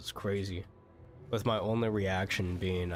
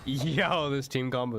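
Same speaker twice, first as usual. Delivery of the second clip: hyped yo; 5 semitones higher, 4 dB louder, slower than usual. Real, not acted. Hyped about his team combo.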